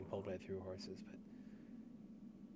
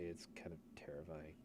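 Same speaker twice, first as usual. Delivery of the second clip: whisper; 4 semitones lower, 9 dB quieter than usual. Real, not acted. Calls the realm terrifying.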